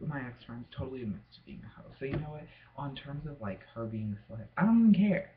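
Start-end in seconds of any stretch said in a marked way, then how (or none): 4.6-5.3 exasperated outburst. Real, not acted. Loud dismissal of ex-friend.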